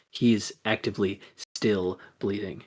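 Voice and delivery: usual speaking voice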